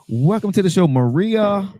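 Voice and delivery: high, rousing guest introduction; show host introduction. Real, not acted. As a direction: announce the guest with a big high lift.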